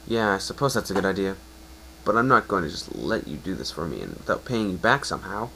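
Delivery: plain reading voice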